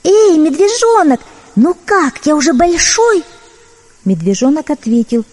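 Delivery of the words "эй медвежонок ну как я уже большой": high calling kitten voice. Acted. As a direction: call down from a treetop. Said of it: "котёнок сверху крикнул".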